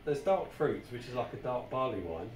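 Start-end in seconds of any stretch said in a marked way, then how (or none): none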